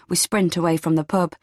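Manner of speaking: usual speaking voice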